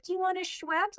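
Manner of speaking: high chirpy stewardess offer; mock-cheerful flight attendant voice; quoting the stewardess. Stewardess offering a drink to a sick passenger, mock-chirpy. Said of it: "She's like".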